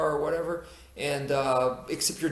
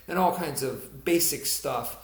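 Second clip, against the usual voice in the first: usual speaking voice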